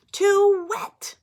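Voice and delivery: very high, loud whiny complaint; whiny pigeon. Acted. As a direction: wail it like a fussy kid. Pigeon complaining about bath.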